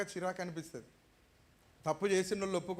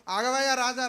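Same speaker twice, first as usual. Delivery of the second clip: eager call to the king; 3 semitones higher, 8 dB louder than usual. Retold or imitated. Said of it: "రమ్మని ఎగిరంగా వెళ్తాను".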